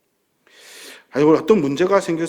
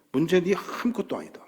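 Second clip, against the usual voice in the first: scornful whisper; 2 semitones higher than usual. Acted. Whispered end of the defiance.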